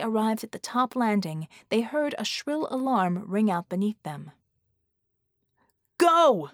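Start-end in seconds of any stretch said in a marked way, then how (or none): 6.0-6.5 sharp high yelled command; shout. Acted. Red shouting at his team to go. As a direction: bark it over the alarm. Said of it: "Red shouted".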